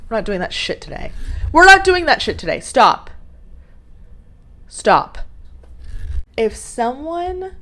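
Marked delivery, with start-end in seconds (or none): 1.5-2.6 high, loud, fast scolding; scolding shout. Real, not acted. Vlogger scolding her blinking lights, high angry shout. 2.6-5.2 loud one-word order; sharp command. Real, not acted. Vlogger ordering her blinking lights to stop, loud sharp command.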